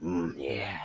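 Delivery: very low, soft porno voice. Shown as a demonstration. Demonstrating the dirty biscuit line, porno voice. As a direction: drop it deep and quiet, mock seductive. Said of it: "do it in a porno voice".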